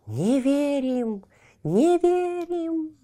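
slow high eerie chant, rising; clamoring trees. Acted. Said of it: "расшумелись деревья".

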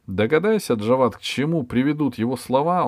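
usual speaking voice

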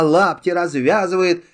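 usual speaking voice

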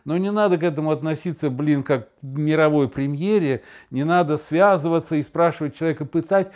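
normal talking voice

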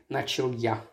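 ordinary delivery, nothing marked

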